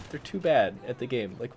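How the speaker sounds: normal talking voice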